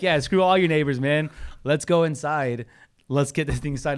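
loud, high, fired-up urging; rally him loudly, as if retelling a victory; retold rallying cry. Agent urging seller back inside, fired-up rallying.

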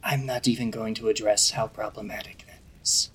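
hushed, whispery cat-spirit voice; low whispered put-down; Igni. Acted. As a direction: say it under your breath, narrow-eyed and disapproving. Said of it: "Igni narrows its eyes and goes".

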